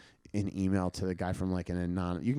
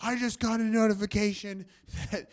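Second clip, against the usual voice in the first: shrill angry Danny; 11 semitones higher, 6 dB louder than usual. Retold or imitated. Danny rants at the comedian about his hacked email, high and outraged. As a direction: rant like an outraged stranger. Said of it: "And he went".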